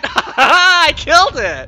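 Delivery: high, loud and slow, laughing; real delighted victory laugh; laughing triumph. Real, not acted. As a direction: gloat through a laugh of relief. Victory over the serpent.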